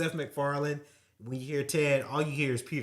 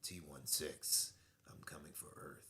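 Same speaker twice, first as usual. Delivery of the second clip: Batman voice; 10 semitones lower, 16 dB quieter than usual. Shown as a demonstration. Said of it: "it's like the Batman voice".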